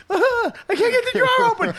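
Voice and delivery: high, loud panicked wail; panicked crying. Retold or imitated. Imitating crying cashier who can't open drawer, panicked. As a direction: wail it in terror. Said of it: "she started crying".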